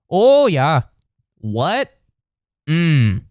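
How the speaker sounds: high, mocking Minnesota accent; Upper Midwest accent. Mocking people in Michigan, Wisconsin and Minnesota, hammed-up Upper Midwest accent. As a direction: exaggerate the Upper Midwest vowels. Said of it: "those people sound ridiculous".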